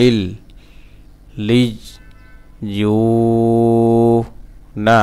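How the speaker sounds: "ইল। লিইয়ুনা" in pieces with gaps, long held "you"; broken syllable-by-syllable. Shown as a demonstration. Teacher showing the stretched vowel, syllable by syllable. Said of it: "এক আলিফ টান দিতে হবে".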